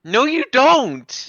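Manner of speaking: high, slow teasing no; mocking sing-song. Mocking argument, high drawn out.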